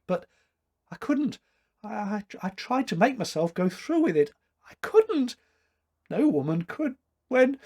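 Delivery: high, trembling and near tears; quavering. Acted. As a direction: let the voice tremble and break. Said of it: "Her voice trembled into silence".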